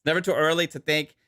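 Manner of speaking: mock Canadian accent reading a sponsor ad. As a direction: sell the razor like a joking Canadian, eh. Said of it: "he's definitely Canadian right now".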